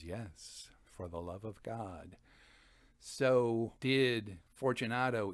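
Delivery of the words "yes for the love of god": much softer, lower, mocking echo; a low, soft, cold echo of the plea; cold reply. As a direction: hand his plea back to him, coldly quiet. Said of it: "and Montresor says".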